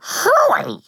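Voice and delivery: high, piping disbelief; high shocked echo. A narrator's reading. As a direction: squeak it back, scandalized.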